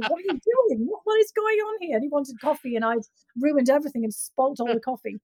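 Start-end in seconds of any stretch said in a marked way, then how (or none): 0.0-2.0 high, incredulous "what is going on here"; imitating a startled man catching the mess; mock baffled demand. Man walking in on the coffee chaos, mock incredulous. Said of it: "this guy comes in".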